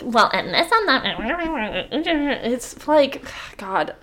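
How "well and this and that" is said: slow, mumbled mimicry of a quibbler; mock nitpicker. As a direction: drag it out, imitating a tiresome critic. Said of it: "be that person who's gonna sit there".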